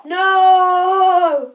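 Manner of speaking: shouted "No"; loud refusal. Real, not acted. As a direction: yell the refusal. Refuses loudly.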